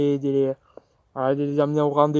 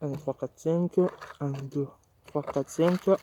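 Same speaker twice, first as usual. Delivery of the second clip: very soft, counting under breath; quiet counting; 7 dB quieter than usual. Real, not acted. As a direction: count almost silently to yourself. Counting quietly while eating.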